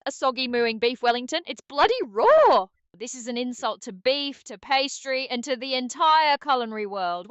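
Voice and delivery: put-on roast them in an angry British accent; British Chef Ramsay. AI co-host roasting stream viewers as Chef Ramsay, irate British accent. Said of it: "can you do it in a British accent".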